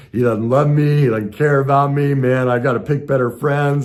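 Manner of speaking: self-pity. Retold or imitated. Friend won't reply, mock hurt whining. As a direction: spiral into wounded whining. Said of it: "I went right into this".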